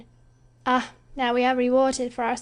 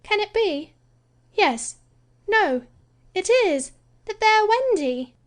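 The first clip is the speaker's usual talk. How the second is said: high and louder, breathless discovery; excited reveal; 13 semitones higher, 4 dB louder than usual. Acted.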